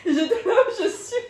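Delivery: high and loud, laughing all through; barely able to speak for laughing. Real, not acted. Laughing hard as she tells her friend about her teeth.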